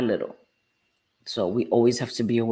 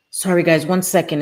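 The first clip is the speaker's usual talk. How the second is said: louder, higher call off to the side; calling out aside; 4 semitones higher, 5 dB louder than usual. Real, not acted. Tells her viewers she must step away, louder. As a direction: break off to answer her son.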